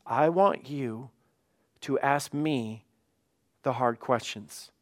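measured phrases with pauses between them; God's invitation, delivered slowly and deliberately; slow, deliberate emphasis. Retold or imitated. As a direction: lay it out slowly, piece by piece. Repeats God's words slowly for emphasis.

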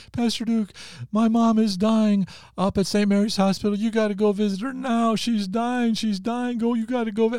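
very high, frantic wail of a woman in tears; imitated tearful plea. Church lady begging her pastor to visit her dying mom, imitated tearful urgency. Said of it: "comes to me in tears".

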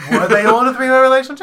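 insistent. Real, not acted. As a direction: press the question again. Repeats unanswered question insistently.

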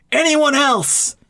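high, drawn-out mock call; joking character. Reviewer imitating a film character's ill-timed joke, mock call. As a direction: call it out slowly, sending up the joke. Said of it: "the other character pauses and goes".

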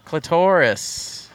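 Clitoris drawn out very slowly; slow and deliberate; slow deadpan repeat. Shown as a demonstration. Slow mock-serious echo.

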